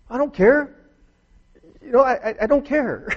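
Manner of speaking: high, loud snapping retort; irritated outburst. Retold or imitated. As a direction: bite back at the wife on the phone, annoyed. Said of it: "irritable and irritated".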